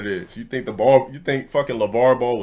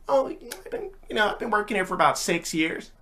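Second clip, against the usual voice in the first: worker voice; 9 semitones higher, 6 dB quieter than usual. Retold or imitated. Voicing ice cream worker.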